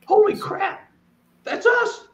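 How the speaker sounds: very high, tearful squeak; overwhelmed, crying out the realization; choked up. Real, not acted. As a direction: voice leaping up as tears take over. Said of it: "I'm getting all".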